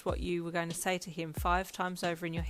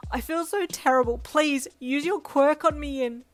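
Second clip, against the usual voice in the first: loud, high, desperate begging; sobbing plea; 8 semitones higher, 11 dB louder than usual. A narrator's reading. Mum pleading for her daughter's quirk, sobbing. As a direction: beg as if falling apart. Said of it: "she sobbed".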